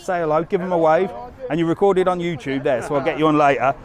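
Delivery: loud, high, upbeat host patter; loud, upbeat live-stream host talking to a fan. Real, not acted. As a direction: talk him up for the viewers, loud.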